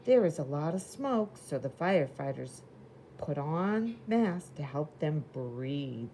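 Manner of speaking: lively picture-book reading voice; reading to children. Acted. Teacher reading a storybook to the class, lively. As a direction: read it bright and clear for little kids.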